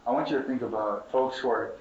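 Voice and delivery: usual speaking voice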